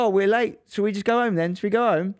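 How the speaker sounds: sarcastic offer to a little son, bright mock cheer. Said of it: "the satire that I'm implying".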